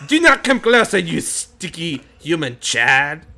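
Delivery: high, loud, slow French warning; menacing mock-French bee; French bee threat. Bee swarm warns player.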